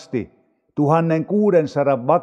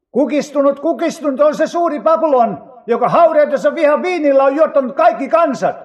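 high, raised declaiming voice; angel's proclamation; 9 semitones higher, 3 dB louder than usual. A narrator's reading. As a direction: cry out the news like a herald. (korkealla äänellä julistaen)

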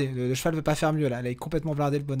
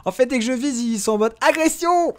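exasperated; 9 semitones higher, 7 dB louder than usual. Real, not acted. Complaining companions too aggressive.

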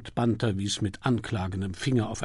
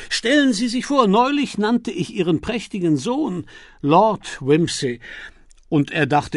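higher, louder booming businessman greeting; hearty American financier; 6 semitones higher, 8 dB louder than usual. Acted. Milligan greets the Duchess at lunch, hearty and loud. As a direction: pump the Duchess's hand in greeting.

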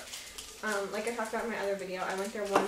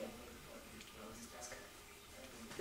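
whisper; 10 semitones lower, 18 dB quieter, much slower than usual. Real, not acted. Whispered aside while sniffing.

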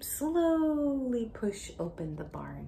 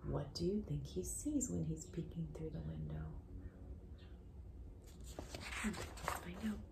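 suspense whisper. Acted. Teacher asking a child in a hushed whisper. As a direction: breathe it out, keep the suspense.

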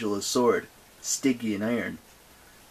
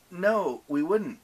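higher-pitched feeble acted agreement; weak agreement; 7 semitones higher, 4 dB louder, slower than usual. A narrator's reading. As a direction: agree half-heartedly in a higher voice. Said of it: "Percy said weakly".

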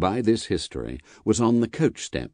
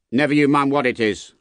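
raised, forceful guard's reply; sharp retort; 6 semitones higher, 4 dB louder than usual. A narrator's reading. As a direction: rebuff the stranger. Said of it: "the guard retorted".

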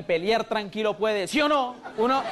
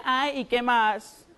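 very slow, drawn-out bored reply; unimpressed wife; much slower than usual. Retold or imitated. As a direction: drag it out, totally unmoved. Wife unimpressed by romantic lines.

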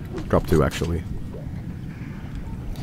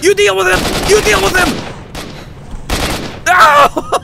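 frantic, high-pitched panic; scared horror game streamer. Real, not acted. Monster right behind him.

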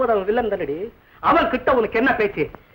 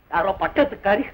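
usual speaking voice

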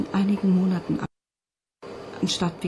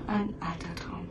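slow whisper; 9 dB quieter, slower than usual. Acted. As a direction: whisper it slowly and softly. Sprecherin flüstert durchgehend. (langsames Flüstern)

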